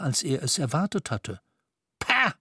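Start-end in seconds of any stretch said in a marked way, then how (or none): 2.0-2.3 high, snarling scoff of pah; rasping pah. A narrator's reading. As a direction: spit out the scoff. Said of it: "schnarrte Scrooge".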